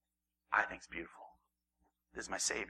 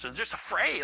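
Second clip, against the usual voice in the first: ordinary talk, nothing marked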